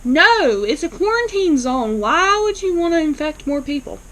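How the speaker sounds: high, emphatic mock disbelief; acted indignation. Answering Greg's question about walk-ins, indignant and incredulous.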